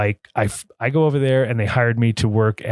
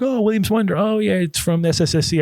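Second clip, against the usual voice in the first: high, bright-eyed recall; light up as if you know it well; eager recognition; 9 semitones higher than usual. Retold or imitated. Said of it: "I was like".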